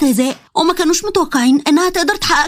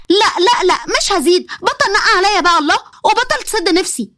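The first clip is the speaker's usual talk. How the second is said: higher, insistent refusal; stubborn; 4 semitones higher than usual. A narrator's reading. Wife refusing her husband's teasing, stubborn. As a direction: dig in, won't give an inch. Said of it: "نسمة قالت بعناد".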